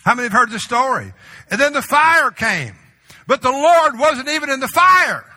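building preacher's roar, high and loud; preaching at full force. Acted. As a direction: drive the storm story up to a shout. Preaches the wind, quake, fire.

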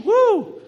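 high, loud "woo"; spontaneous whoop. Real, not acted. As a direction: let out a high preacher's whoop. Preacher whoops after scripture.

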